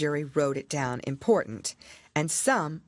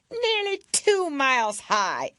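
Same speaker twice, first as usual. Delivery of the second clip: imperious queen; 10 semitones higher, 5 dB louder, slower than usual. A narrator's reading. Queen adds sharply.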